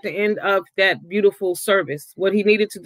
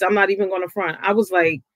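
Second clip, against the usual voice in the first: usual speaking voice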